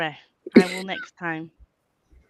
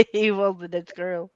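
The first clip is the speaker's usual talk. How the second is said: ordinary talk, nothing marked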